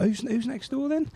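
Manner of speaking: high, put-on voice for Scott's question; voicing Scott. Storyteller voices Scott asking who fishes next door, in a high put-on voice. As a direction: play Scott asking lightly and innocently. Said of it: "So Scott's like".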